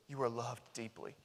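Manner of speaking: almost a caress in words; quiet, loving reassurance; tender assurance. Retold or imitated. Jesus to the unloved.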